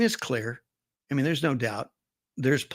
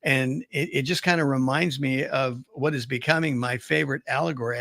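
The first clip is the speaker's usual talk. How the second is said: normal talking voice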